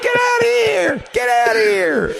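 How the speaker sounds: high, hammed-up frantic shout; mock screaming while running away; panicked yelling. Caught man fleeing for his life, frantic.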